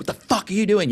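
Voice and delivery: high-pitched outburst, imitating a yell; retold angry yell. Body shop boss re-enacting yelling at his guys, angry outburst. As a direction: re-enact blowing up at someone. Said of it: "I've yelled and screamed at my guys".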